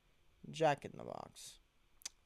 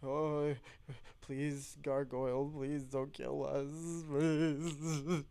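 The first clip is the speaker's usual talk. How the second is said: begging plea; 2 semitones higher, slower than usual. Retold or imitated. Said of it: "I'm like".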